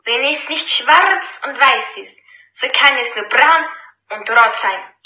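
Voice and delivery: calling out. Acted. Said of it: "ruft er laut in den Saal".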